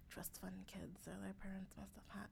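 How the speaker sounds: hushed mock gossip; whispering. Mocking what strangers assume.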